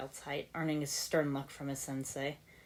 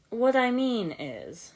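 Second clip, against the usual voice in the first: terse; 7 semitones higher, 5 dB louder, slower than usual. A narrator's reading. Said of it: "sounding somewhat terse at their antics".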